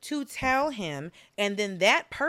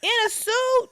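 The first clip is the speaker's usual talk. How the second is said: high-pitched amused disbelief; high, scandalized storytelling; incredulous; 12 semitones higher than usual. Real, not acted. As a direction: draw out the absurd entrance, voice way up. Incredulous retelling of his sudden entrance.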